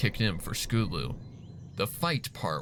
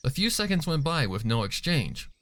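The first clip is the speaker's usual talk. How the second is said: plain reading voice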